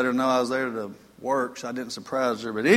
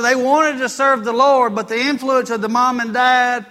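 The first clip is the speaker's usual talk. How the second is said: slow, weighty, raised sermon voice; forceful preaching; 7 semitones higher, 6 dB louder than usual. Acted. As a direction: proclaim it to the congregation with drawn-out emphasis.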